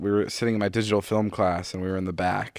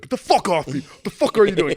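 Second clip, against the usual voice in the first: angry; 10 semitones higher, 4 dB louder than usual. Retold or imitated. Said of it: "the boys would be like".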